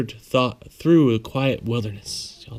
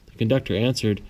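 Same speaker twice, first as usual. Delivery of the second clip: ordinary delivery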